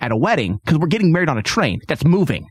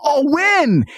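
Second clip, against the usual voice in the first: high, swooping imitated question; eager mock surprise; 10 semitones higher than usual. Barber asking about the wedding, eager mock surprise. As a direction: imitate the barber pouncing on the news.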